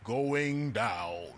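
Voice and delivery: low, flat ride-attendant announcement; deep automated elevator voice. Retold or imitated. The hologram attendant announcing the drop, low. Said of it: "simply says".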